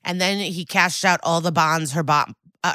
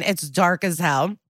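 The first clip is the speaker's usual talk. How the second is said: ordinary talk, nothing marked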